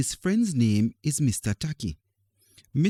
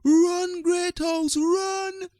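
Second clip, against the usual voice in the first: cheering shout; much slower than usual. Acted. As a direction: cheer your team home. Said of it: "The boys and girls of Great House shout".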